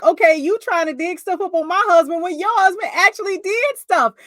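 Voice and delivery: loud, high confrontation; confrontational. Retold or imitated. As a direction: call her out loudly. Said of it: "she is like".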